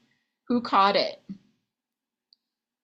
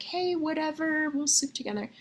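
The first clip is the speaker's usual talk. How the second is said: breezy and much higher, lightly mocked; careless shrug; 8 semitones higher than usual. Mocking her past self agreeing casually, high and breezy. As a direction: toss it off like it doesn't matter. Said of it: "you're like".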